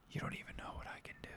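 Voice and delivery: fast, breathy whispered threat; threatening whisper. Acted. [name] taunts Rob, smiling.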